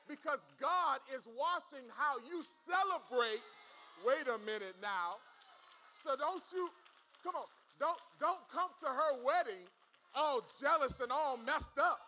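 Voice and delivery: loud, rising preacher's call; full-force preaching. Acted. Pastor warning the church against jealousy, preached loud. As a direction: preach it hard at the crowd.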